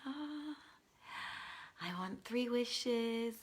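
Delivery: soft, slow, hushed wish to the lamp; a quiet, mock-solemn plea to the genie; making a wish. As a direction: draw it out softly like a solemn spell. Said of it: "I'm gonna go".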